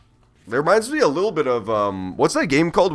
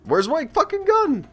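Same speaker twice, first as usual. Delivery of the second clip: much higher, slower, frustrated yelp; exasperated. Real, not acted. Player losing his gun arm, exasperated high cry. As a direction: cry out in disbelief at the broken game.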